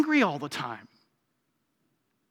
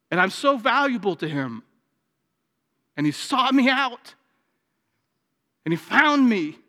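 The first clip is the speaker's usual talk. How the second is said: rising preaching fervor; 7 semitones higher, 6 dB louder than usual. Acted. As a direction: preach it louder and louder. Preaching builds to a climax.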